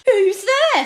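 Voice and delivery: a scared lord calling out into the fog; high-pitched call. Acted. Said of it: "Spittleworth called in a high-pitched voice".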